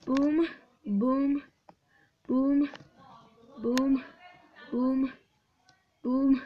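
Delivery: loud, punchy, spaced booms; explosive one-word bursts; calling out blasts. Real, not acted. Calling out each test blast.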